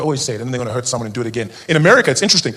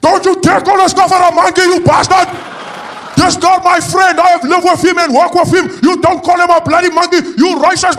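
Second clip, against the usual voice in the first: very high, loud outraged shout, slow at first; furious Afrikaner; 7 dB louder, slower than usual. Retold or imitated. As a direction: defend your friend at full fury. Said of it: "Be like".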